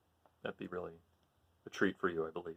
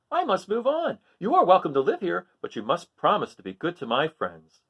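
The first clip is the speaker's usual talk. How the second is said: higher, kindly little crab voice; Hermit Crab voice; 6 semitones higher, 4 dB louder than usual. Acted. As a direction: play the gentle crab, making a promise.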